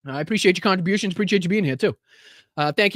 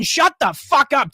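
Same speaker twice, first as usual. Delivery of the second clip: angry; 7 semitones higher, 4 dB louder, slower than usual. Real, not acted. Mocks the politician, then snaps.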